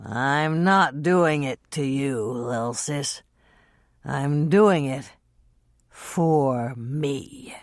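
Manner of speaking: slow, low, slurred words; low slurred drunk voice; slurring. Acted. Drunk Dean.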